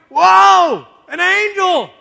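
very loud, high shout of alarm; hammed-up astonished cry; startled Zechariah. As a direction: yell it as if jumping back in shock. Acting out Zechariah startled by angel.

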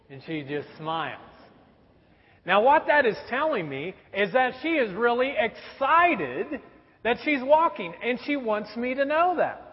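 ordinary delivery

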